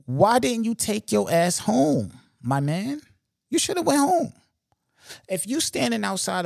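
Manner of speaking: exasperated scolding. Real, not acted. Host scolding the robber who planned the heist, exasperated and loud. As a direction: tell him off in disbelief.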